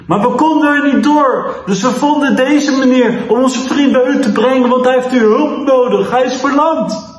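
high-pitched, urgent explanation; urgent calling out. A narrator's reading. Levi explains to Jesus for his paralysed friend, urgent calling. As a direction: plead the case in a high, insistent voice. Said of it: "riep Levi".

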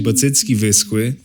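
normal talking voice

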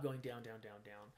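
trailing down to a near whisper; fading away. Shown as a demonstration. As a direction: let the words shrink like a volume knob going down. Volume knob turned down.

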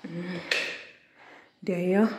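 usual speaking voice

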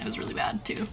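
sheepish whisper; embarrassed whisper; whispered aside. Real, not acted. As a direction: confess it under your breath.

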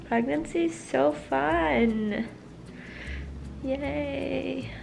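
slow, dry ironic cheer; sarcastic. Real, not acted. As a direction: say it like you mean the opposite. Ironic remark about rough pregnancy.

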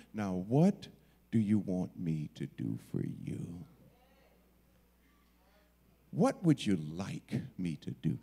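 low, softened, unhurried question; gentle asking. Acted. Jesus asking the man standing in his presence, gentle.

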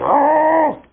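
very loud imitated dog growl; big, long mock growl; dog growl back. Reflection dog growls back harder.

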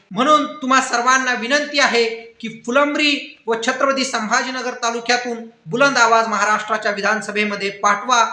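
usual speaking voice

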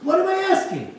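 climbing to a loud, high outcry; listing his efforts, building to loud and high; frustrated man shouting his grievance at the rabbi; upset. Retold or imitated. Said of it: "He was so upset".

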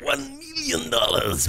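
slow, high, mock villain drawl; Dr. Evil voice. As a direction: savor the sum like a cartoon villain. Mock villain quoting a huge sum.

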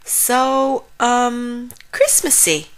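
hesitate, then offer polite praise; politely hesitant. A narrator's reading. Frog politely hides mild disappointment.

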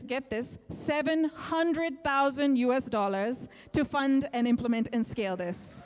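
ordinary delivery

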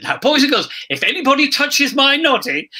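high, mock-stern warning to children; re-enacting a children's show warning; addressing children. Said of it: "I said, and I said".

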